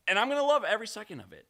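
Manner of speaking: hammed-up joy at the losing; sarcastic glee. Real, not acted.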